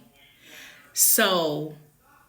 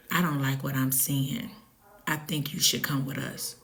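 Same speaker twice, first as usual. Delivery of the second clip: soft, low whisper in the ear; hushed paramedic warning; 4 semitones lower, 7 dB quieter than usual. Retold or imitated. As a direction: lean in and whisper the worry. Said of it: "she whispered in my ear".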